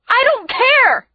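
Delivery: high, defiant cry; scream. A narrator's reading. Sister struggling against her brother's grip, screaming. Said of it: "I scream".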